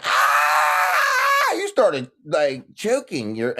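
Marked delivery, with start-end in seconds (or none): 0.0-1.5 high strangled mocking shriek; mock choked yell. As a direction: yelp it like you are choking yourself. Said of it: "and you was like".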